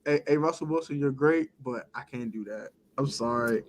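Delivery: soft, apologetic brush-off; polite refusal. Retold or imitated. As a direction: let him down gently and quietly. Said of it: "I said".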